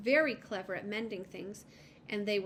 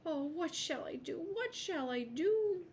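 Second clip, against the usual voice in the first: a little lizard wailing in despair; sad lament; 8 semitones higher than usual. A narrator's reading. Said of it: "a sad voice close behind".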